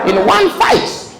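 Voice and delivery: very high, slow squeak on "in one fight"; squeaky punchline. Acted. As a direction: stretch it out in a squeal.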